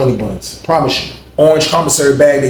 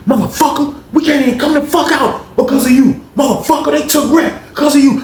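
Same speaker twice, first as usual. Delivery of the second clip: high, accusing yell; retold angry yell; 11 semitones higher than usual. Cellmate yelling blame at honey bun man, high angry yell. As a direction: blame him hard.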